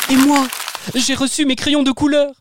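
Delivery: put-on high, delighted kid voice; amazed child. Booster telling the parents about the crayons, high amazed voice. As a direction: marvel at new crayons like a child. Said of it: "s'émerveilla Booster".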